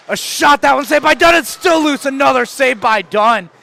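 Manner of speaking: fast, loud play-by-play burst; excited call. Real, not acted. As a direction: call the saves at full pitch. Calling rapid saves.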